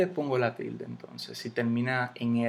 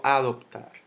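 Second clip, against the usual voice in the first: "adoptar" hit on the opening A; misplaced stress; 4 dB louder than usual. Shown as a demonstration. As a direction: punch the first syllable of "adoptar" as a wrong example. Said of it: "Yo no digo".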